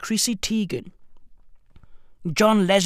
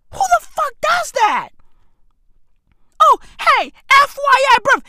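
high, loud mocking squeal; 6 dB louder than usual. Podcaster mocking Chrissy Teigen's FYI post, high loud squeal. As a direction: shriek it up high in mockery. Mock outrage and mock announcement.

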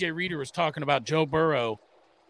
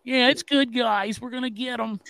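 higher, mild mock pep talk; Andy Dalton pep talk; 6 semitones higher than usual. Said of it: "Andy Dalton pep, pep talk".

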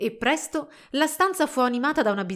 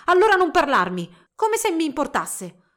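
high, loud huffy retort; exasperated outburst; 7 semitones higher, 8 dB louder than usual. A narrator's reading. Young woman snubbing her silent rival, loudly exasperated. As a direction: flare up as if you don't care. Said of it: "scattò Svanilda".

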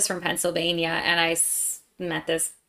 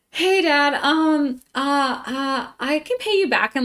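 higher-pitched, halting ask full of ums; hesitant plea; 7 semitones higher, 4 dB louder, slower than usual. Retold or imitated. Daughter asking Dad to pay for a trip, hesitant. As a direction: stall sheepishly before asking a favour.

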